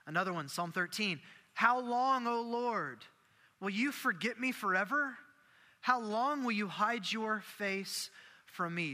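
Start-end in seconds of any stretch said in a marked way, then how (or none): 1.5-8.9 raised, higher-pitched angry plea; David, angry. Acted. Preacher reads David's psalm of complaint to God, angry plea. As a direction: demand an answer in a higher, insistent voice. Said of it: "the anger in David's voice".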